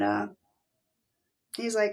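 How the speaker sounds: normal talking voice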